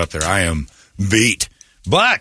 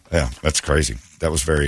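ordinary delivery

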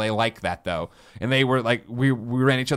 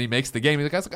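ordinary talk

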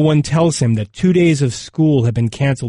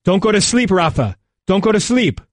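urgent high-pitched narrated shout; 7 semitones higher than usual. A narrator's reading. Rescuer keeps an injured man awake, restrained shouting. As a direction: shout it high, holding back the volume. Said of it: "One keeps shouting".

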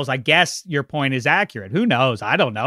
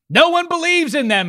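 much higher, hammed-up sports-cliché voice; mock cliché voice; 14 semitones higher, 3 dB louder than usual. Co-host mocking the underdog cliché, hammed-up high voice. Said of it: "it's been said this year".

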